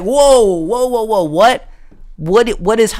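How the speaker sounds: high, loud startled whoa; retelling his own shocked yelp. Retold or imitated. His shocked reaction retold.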